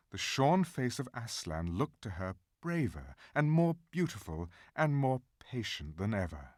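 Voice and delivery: ordinary delivery, nothing marked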